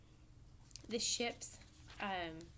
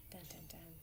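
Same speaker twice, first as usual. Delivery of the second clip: soft, low dun-dun-dun; hushed imitation of a dramatic soundtrack cue; murmured cliffhanger sting; mock suspense sting; 4 semitones lower, 13 dB quieter than usual. Mock suspense sting after reveal.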